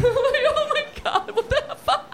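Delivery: very high, drawn-out squeal; hammed-up high-pitched dismay; shocked disbelief. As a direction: squeal it in mock horror, slowly. Retold shock at being ratted out.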